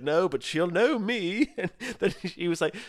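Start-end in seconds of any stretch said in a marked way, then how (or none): 0.0-1.5 high, drawn-out self-important celebrity; slow, pitched-up mock grandeur; Christopher Biggins. Friend retelling Biggins crowbarring in his fame, slow mock-grand voice.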